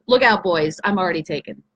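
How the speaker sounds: ordinary talk, nothing marked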